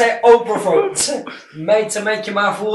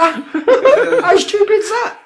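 much higher, slow laughing words; 13 semitones higher, much slower than usual. Real, not acted. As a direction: laugh through the question. Laughing at Tom.

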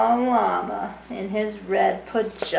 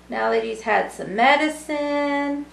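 ordinary delivery

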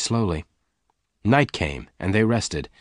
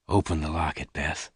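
low, soft man's voice; gentle; 4 semitones lower, 7 dB quieter than usual. A narrator's reading. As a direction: ease her into it, lower and softer. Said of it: "Lassiter said gently".

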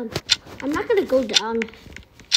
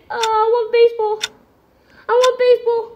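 whining demand; 6 semitones higher, 5 dB louder than usual. Real, not acted. Left without a baseball, protesting.

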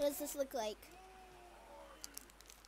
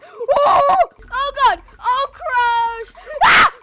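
high frantic yelling; panicked shouting; 8 semitones higher, 9 dB louder than usual. Real, not acted. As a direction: scream it in real panic. Panicked in game chase.

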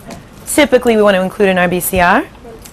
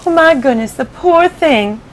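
higher, slowing ladybug pity; 6 semitones higher, slower than usual. Acted. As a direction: coo over poor James. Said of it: "the ladybug cried".

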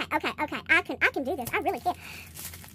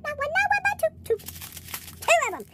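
squealing high on the second two; thrilled; 10 semitones higher than usual. Real, not acted. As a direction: build to a delighted high squeal. Second rare bag found.

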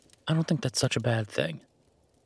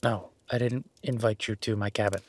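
ordinary talk

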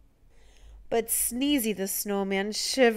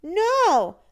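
high, loud no; children's alarmed yell; 9 semitones higher, 7 dB louder than usual. A narrator's reading. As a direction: shout it to stop him. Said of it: "The children yelled".

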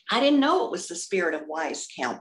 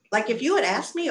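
usual speaking voice

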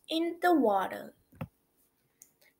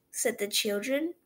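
plain reading voice

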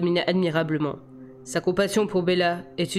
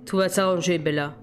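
ordinary delivery

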